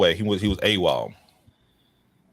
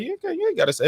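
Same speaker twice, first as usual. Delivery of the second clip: high mock voice. Telling Stacy, high voice.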